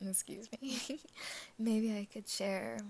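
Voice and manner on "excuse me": usual speaking voice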